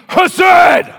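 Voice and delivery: sudden high, booming shout; screamed. Retold or imitated. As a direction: scream it out like a fiery professor. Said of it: "he screamed".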